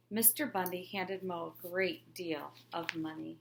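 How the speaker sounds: ordinary delivery, nothing marked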